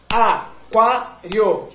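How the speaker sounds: "aquário" drawn out syllable by syllable. Shown as a demonstration. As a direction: clap "aquário" out one syllable per beat. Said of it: "enquanto você tá falando as sílabas".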